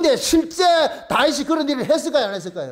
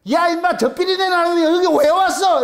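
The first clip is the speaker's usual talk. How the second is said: Goliath taunting; 5 semitones higher, 4 dB louder than usual. Retold or imitated. Goliath mocks David.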